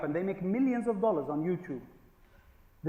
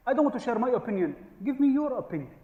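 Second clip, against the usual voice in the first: higher, faster mock boss voice; mock manager; 7 semitones higher, 3 dB louder, faster than usual. Comedian's joke mocking a manager, higher and faster. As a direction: parody a boss pretending to want input.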